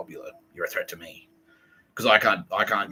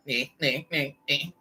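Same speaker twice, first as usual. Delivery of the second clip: high nonsense nagging noises; mocking nagging; 6 semitones higher, slower than usual. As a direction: imitate a pest whining in little yaps.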